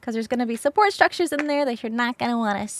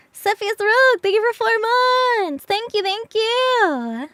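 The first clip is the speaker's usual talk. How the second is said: excited thanks; 10 semitones higher, 5 dB louder than usual. Real, not acted. Thanking a subscriber aloud.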